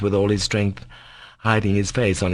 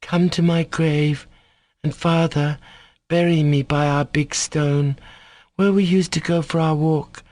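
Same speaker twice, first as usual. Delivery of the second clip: put-on sick boy, pleading; 7 semitones higher than usual. A narrator's reading. Dying boy asks his father not to cry, pleading. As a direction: plead tenderly through sorrow.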